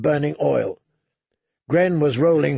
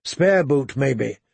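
plain reading voice

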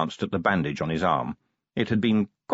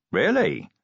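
high, impressed little question; genuinely impressed; 12 semitones higher, 3 dB louder than usual. A narrator's reading. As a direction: sound truly impressed. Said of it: "genuinely impressed".

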